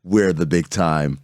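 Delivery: low, slow smug reply; mock self-important. Host answering the congratulations, mock self-important. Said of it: "I'm like".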